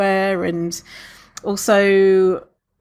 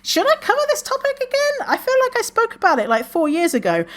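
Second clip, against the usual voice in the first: much higher, anxious self voice; fretful self. Retold or imitated. Host fretting to herself about repeating topics. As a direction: squeak out the worry as your past self. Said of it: "I'm like".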